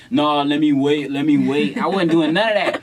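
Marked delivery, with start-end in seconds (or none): 0.1-1.8 corny, hesitant mock voice. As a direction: imitate a shy guy holding back. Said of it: "I wasn't doing that corny".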